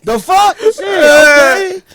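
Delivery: high, drawn out in disbelief; a caught-off-guard, high exclamation; stunned reaction. Retold or imitated. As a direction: let the shock stretch the words out slowly. Retelling own shocked reply.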